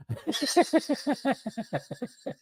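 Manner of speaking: high, breathy burst of laughter that trails down; cracking up at his own joke; laughing at himself. Real, not acted. Caller laughing at his own bad Trump impression.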